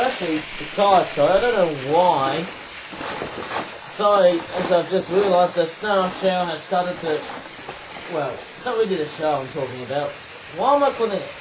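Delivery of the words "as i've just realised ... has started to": ordinary talk, nothing marked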